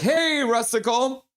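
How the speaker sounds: high, drawn-out hello; excited greeting. Acted. Streamer voicing viewers greeting him, excited greeting. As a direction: sing out the hey up high.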